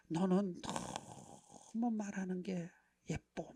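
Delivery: slow, soft and tender; slow soft praise, lovingly drawn out. Retold or imitated. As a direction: coo it gently to a beloved daughter.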